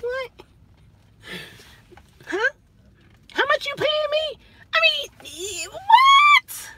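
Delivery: shrill high cry of disbelief; mock outrage. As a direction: shout what, voice cracking high. Outraged reaction at low pay.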